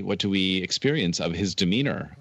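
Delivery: ordinary talk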